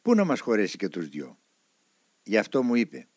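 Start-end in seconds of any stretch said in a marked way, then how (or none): none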